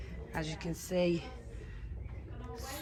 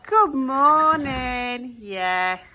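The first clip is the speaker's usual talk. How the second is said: slow, high, drawn-out pet greeting; cooing to horses; 8 semitones higher, much slower than usual. Real, not acted. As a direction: stretch it out sweetly for the horses. Greeting each horse slowly.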